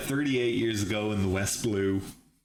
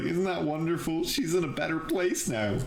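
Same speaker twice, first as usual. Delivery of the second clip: higher, lightly tearful mock-cheerful voice; tearful father; 7 semitones higher than usual. Crying dad consoling his son, mildly tearful. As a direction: put on a brave higher voice through mild tears. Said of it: "His dad's crying".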